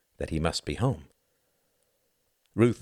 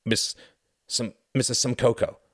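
halting, pitched-up false starts; flustered stumbling; 5 semitones higher than usual. Acted. Host unsure what to call guest, flustered. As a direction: trip over the title, then push through.

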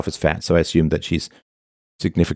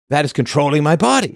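mock woman quote; 6 semitones higher than usual. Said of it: "women say".

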